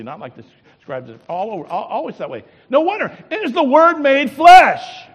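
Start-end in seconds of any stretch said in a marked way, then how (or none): 2.7-5.1 preaching at full force. Acted. Preacher to his congregation, loud soaring preaching. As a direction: cry it out as the great truth.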